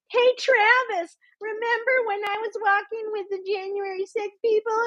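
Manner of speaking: sneering high impression of a woman boasting; mocking ditzy woman. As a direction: mimic her as a silly airhead. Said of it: "she was like".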